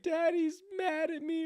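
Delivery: put-on very high, slow child's whimper; sad little daughter. Dad imagining his daughter's words, in a very high slow child voice. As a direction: plead like a hurt toddler. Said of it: "my daughter be like".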